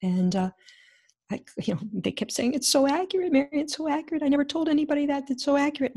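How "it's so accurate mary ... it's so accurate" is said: much higher, excited friends gushing; a delighted high-pitched imitation of friends; amazed friends. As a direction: marvel at the reading, gush. Friends marveling, retold.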